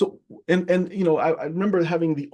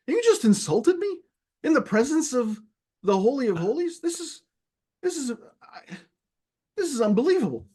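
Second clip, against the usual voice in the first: much higher pitched, incredulous inner outcry; a kid's scandalised thought, pitched way up; outraged disbelief; 8 semitones higher than usual. Retold or imitated. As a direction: sputter in shocked indignation at the insult. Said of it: "And I'm thinking".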